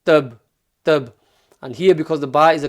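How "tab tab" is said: tab with a bounced final b; tab, final b released with a bounce. Shown as a demonstration. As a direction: pop the b at the end of tab. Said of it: "all you're gonna say is the ba from its makhraj and then finish".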